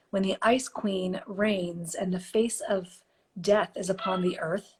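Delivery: usual speaking voice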